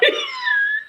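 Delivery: a shrill burst of laughter; high squealing laugh. Real, not acted. Telling friends the story, squealing laugh. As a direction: let out a high cackle.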